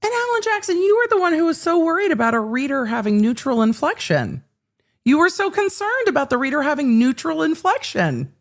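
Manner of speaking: high-pitched sarcastic needling; mocking indignation. Real, not acted. Commentator mocks lawyer's worry about neutral inflection, mockingly indignant.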